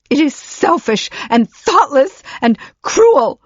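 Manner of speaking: high, loud, breath-shaken accusation; unsteady furious accusation. Acted. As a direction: spit out each word in anger. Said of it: "snatched in an unsteady breath".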